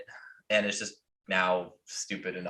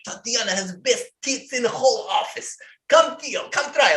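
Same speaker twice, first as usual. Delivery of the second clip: high, loud lecherous boasting; leering boss; 13 semitones higher, 4 dB louder than usual. Retold or imitated. Boss Vlad offering Theo a grab, in a high loud leering brag. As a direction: brag like a crude boss showing off.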